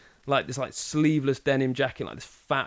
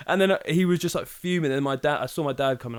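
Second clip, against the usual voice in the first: ordinary talk, nothing marked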